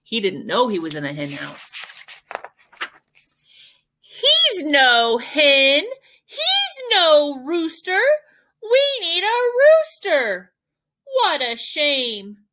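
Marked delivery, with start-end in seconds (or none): 4.0-12.4 high, drawn-out hen complaints; disapproving hens. Acted. Hens size up the owl as rooster, high complaining voices. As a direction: voice fussy hens, pitched well up.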